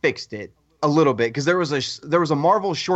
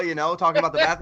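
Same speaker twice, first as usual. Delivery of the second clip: ordinary talk, nothing marked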